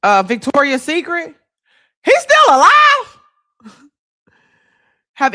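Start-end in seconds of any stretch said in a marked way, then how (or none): 2.0-3.2 much higher, louder disbelieving question; stunned to hear he's alive; shocked. Real, not acted. Streamer reacting in shock to her chat about Wexner, surprised.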